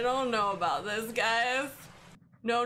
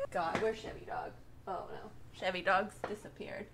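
softer, breathy worried whisper; 7 semitones lower, 10 dB quieter, faster than usual. Real, not acted. Gamer losing the dog in the woods, worried whisper. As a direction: whisper as if the dark might hear. Dog vanished in horror game.

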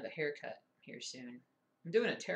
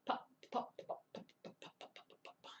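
mock pops; 5 dB quieter than usual. Imitating the hip popping.

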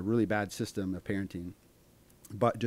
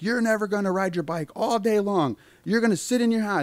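high-pitched mock threat, getting louder; escalating parent; 11 semitones higher, 5 dB louder than usual. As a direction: lay down an over-the-top punishment. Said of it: "they just start to escalate".

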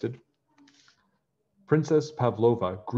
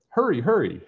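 loud, high urgent call; 6 semitones higher, 6 dB louder than usual. A narrator's reading. Princess calling loudly to hurry her servants. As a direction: call the servants across the castle. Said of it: "she called to her servants".

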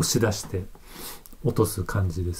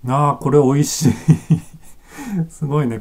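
higher, louder, delighted exclamation ending in a laugh; real delight; 5 semitones higher, 5 dB louder than usual. Real, not acted. Beer reviewer reacting to a tasty first sip, delighted. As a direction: burst out pleased, then laugh.

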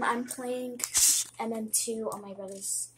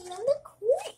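much higher, rising call; high exclamation; 11 semitones higher, 4 dB louder than usual. Real, not acted. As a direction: lift it high at the end. Repeats it higher.

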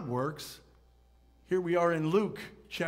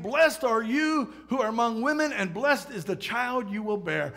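raised, exultant proclamation; loud exclamation; 5 semitones higher, 4 dB louder than usual. A narrator's reading. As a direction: exclaim the blessing in a high, strong voice. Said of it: "in a loud voice she exclaimed".

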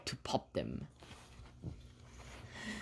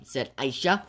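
plain reading voice

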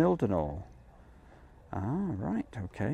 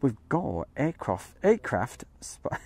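slow, drawn-out posh voice; posh drawl; slower than usual. Shown as a demonstration. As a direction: stretch it out like a plummy announcer. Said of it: "I nearly did the posh bit then".

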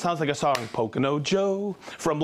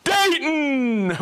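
excited shout; 10 semitones higher, 3 dB louder, much slower than usual. Real, not acted. Host hyping a live viewer, loud high call-out.

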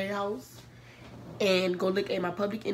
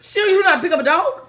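high, loud disbelieving yell; shout; 10 semitones higher, 13 dB louder than usual. Real, not acted. Outburst at sister.

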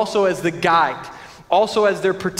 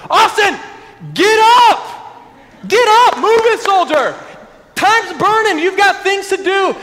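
loud, much higher drill-sergeant shout; drill sergeant yell; 13 semitones higher, 7 dB louder than usual. Retold or imitated. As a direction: bark orders like a drill sergeant waking a soldier. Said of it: "get in the face and be like".

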